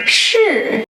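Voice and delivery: 是 said alone, clearly, falling tone; model pronunciation. Shown as a demonstration. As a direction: isolate 是 for students to copy. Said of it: "How to pronounce 是".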